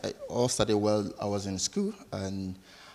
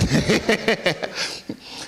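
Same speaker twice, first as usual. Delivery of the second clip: breathy laugh; 9 semitones higher, 3 dB louder than usual. Real, not acted. Guest laughing after train joke.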